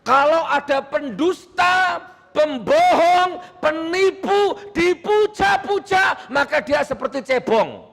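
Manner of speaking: high, slow, shouted accusations; fiery denunciation. Real, not acted. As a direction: hammer out each accusation, drawn out and high. (mengecam lantang, tinggi dan dipanjangkan)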